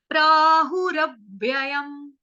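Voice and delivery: प्राहुरव्ययम drawn out slowly, each syllable clear; slow syllable demo. Shown as a demonstration. Teacher showing students how to say प्राहुरव्ययम, slowly. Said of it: "जैसे पंजाबी में भी भाई को क्या बोलते हैं".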